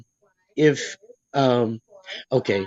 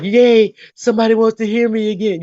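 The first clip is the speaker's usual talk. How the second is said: excited; 8 semitones higher, 3 dB louder than usual. Retold or imitated. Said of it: "I was like".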